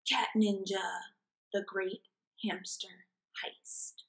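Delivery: ordinary delivery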